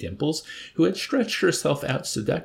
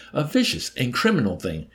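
higher-pitched, indignant rebuke; reproachful; 4 semitones higher, 3 dB louder than usual. A narrator's reading. Nately condemns the attack on his officer, reproachful. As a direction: press the reproach home. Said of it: "Nately shouted at him reproachfully".

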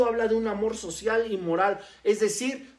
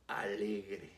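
alegre. dropped much lower and soft, trailing away; soft first take; 12 semitones lower, 13 dB quieter than usual. Shown as a demonstration. Preacher quietly introducing the word joyful, soft and low. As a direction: let alegre. fall away almost to a murmur.

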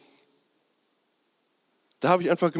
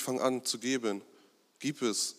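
the call of giving, softer and fading; preacher voices a call that grows quieter, softer; 3 semitones lower, 8 dB quieter than usual. Retold or imitated. Let the call of giving drift away, softer. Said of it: "Und es wird immer leiser".

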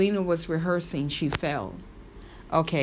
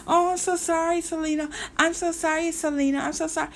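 much higher, whiny fake apology; mock groveling Yolanda; 10 semitones higher, faster than usual. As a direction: squeak out a sniveling sorry to mock her. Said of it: "she'd have been looking at me like".

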